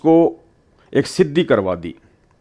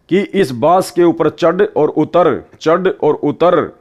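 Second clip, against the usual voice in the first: a hint of a guru slowly laying out the chore, word by word; slow spelled-out order; 2 semitones higher, slower than usual. Guru tells disciple to order the jinn, slowly spelled-out command. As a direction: drag out each step of the command. Guru dictating endless task.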